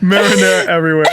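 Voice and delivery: trashy Brooklyn woman. Retold or imitated. Mimicking a friend's successful line, laughing Brooklyn accent. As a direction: echo it in a put-on Brooklyn voice. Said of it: "think about, like, a trashy".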